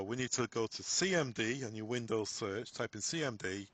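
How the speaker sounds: normal talking voice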